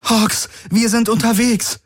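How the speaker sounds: high-pitched urgent shout; urgent call. Acted. Figur ruft Gefährten über Funk zu. (dringend und hoch gerufen)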